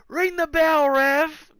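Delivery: high, loud crowd shout; imitated fan yell. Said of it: "fan yell".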